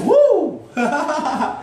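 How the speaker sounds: high whoop into a laugh. Real, not acted. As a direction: burst into a giddy laugh. Bursts out laughing mid-talk.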